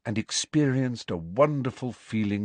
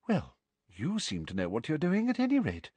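prim sweet witch voice; 7 semitones higher, 4 dB quieter than usual. Acted.

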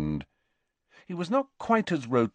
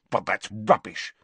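snapped. A narrator's reading. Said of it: "snapped Harry".

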